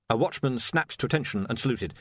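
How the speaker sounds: ordinary delivery, nothing marked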